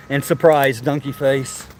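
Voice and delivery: usual speaking voice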